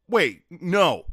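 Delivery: loud sudden no; loud refusal. Retold or imitated. Bartender stopping a banned man returning, loud. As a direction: stop him at the door.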